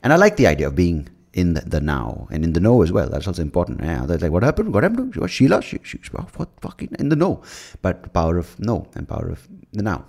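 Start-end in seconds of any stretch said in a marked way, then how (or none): 4.3-7.4 very high squeaky mock confusion; confused friend mishears the now, squeaky high mock voice. Mocking a confused listener.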